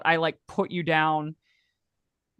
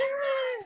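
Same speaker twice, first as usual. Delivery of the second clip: high shrieking laugh; 4 dB quieter than usual. Acted. Laugh after the townsperson's shout.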